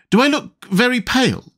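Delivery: very high-pitched, fretful query; anxious question. A narrator's reading. Tweedledum being armed for battle, high anxious question.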